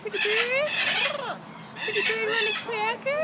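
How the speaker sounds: high parrot-like voice; parakeet chatter. Pretend. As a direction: chirp it like a pet parrot. Talking to a parrot.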